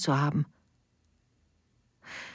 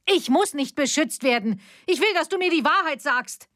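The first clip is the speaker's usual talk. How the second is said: high, sharp insistence; defiant demand; 9 semitones higher than usual. A narrator's reading. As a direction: reject her protection outright. Daughter angrily refuses protection.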